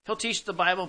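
ordinary delivery

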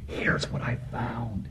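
soft, slow whisper. Acted. Preacher telling the church what believers say, slow hushed whisper. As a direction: lean in and whisper it like a secret. Sharing a testimony quietly.

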